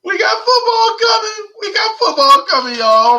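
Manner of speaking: giddy, cracking high with excitement; emotional hype. Real, not acted. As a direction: voice leaping way up with football excitement. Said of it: "I'm getting emotional".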